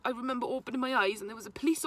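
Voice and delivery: ordinary talk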